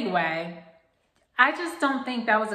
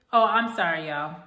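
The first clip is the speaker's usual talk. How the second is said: ordinary talk, nothing marked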